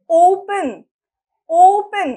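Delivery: ওপেন, loud with a stretched first vowel; long O sound. Shown as a demonstration. Teacher showing that it takes time, long O in ওপেন. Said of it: "time লাগছে তো।".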